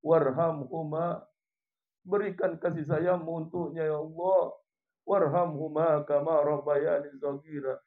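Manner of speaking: earnest supplication, dying away; pleading prayer. Real, not acted. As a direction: beg God's mercy, losing volume toward the end. Impassioned closing of prayer.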